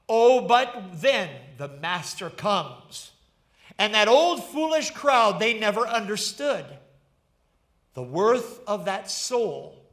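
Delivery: lightly dramatic reading that builds then sinks; poetic preaching. Acted. Preaches the poem's meaning.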